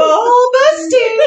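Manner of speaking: high, slow repeat with a cry; exclamation on request. Acted. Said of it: "Do it again".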